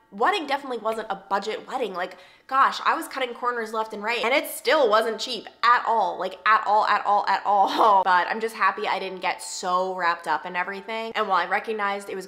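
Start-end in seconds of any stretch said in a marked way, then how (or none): 4.2-8.0 building louder on at all, at all; emphatic repetition. Real, not acted. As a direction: lean harder each time you say at all. Stressing it was not cheap.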